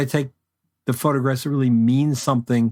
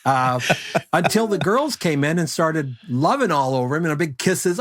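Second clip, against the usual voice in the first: usual speaking voice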